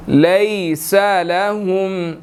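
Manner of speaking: slow, drawn-out tajweed recitation; slow Quranic recitation. Shown as a demonstration. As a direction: chant each word slowly. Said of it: "teacher recites the verse".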